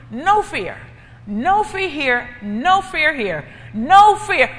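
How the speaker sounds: slow steady no fear, swelling to a loud cry; slow, rising declaration of no fear; building proclamation. Acted. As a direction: build each no fear until it rings out. Jesus voiced, building up.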